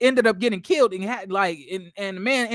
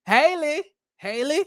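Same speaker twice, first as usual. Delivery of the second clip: mock questioning call; 4 semitones higher, slower than usual. Mock call to correct actress.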